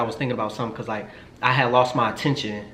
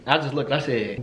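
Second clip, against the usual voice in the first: ordinary delivery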